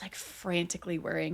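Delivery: unvoiced hushed aside; whisper. Real, not acted. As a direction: breathe it out softly. Describing hero's worry.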